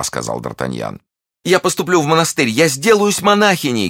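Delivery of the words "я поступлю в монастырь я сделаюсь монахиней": very high, loud frantic plea; desperate pleading. A narrator's reading. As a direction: beg for her life. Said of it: "говорила миледи". (отчаянная мольба)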